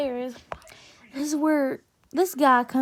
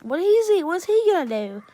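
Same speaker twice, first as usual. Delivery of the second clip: high, loud scoffing dare; defiant challenge; 5 semitones higher, 6 dB louder than usual. Retold or imitated. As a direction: fire back a dismissive challenge. Retold defiant challenge to girlfriend.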